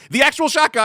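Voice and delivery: high loud gleeful call; calling shotgun. Pretend. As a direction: shout it to claim the seat. Joking dibs call.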